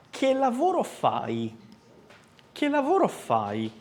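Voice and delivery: fai ending in a clear i; clear final i. Shown as a demonstration. Teacher drilling che lavoro fai. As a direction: land the i of fai. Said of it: "è che lavoro fai".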